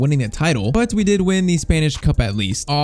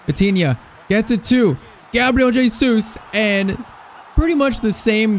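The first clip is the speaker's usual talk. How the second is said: excited shout; 6 semitones higher than usual. Real, not acted. Player shouting at the screen as Jesus scores. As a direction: roar him through on goal. Second goal building.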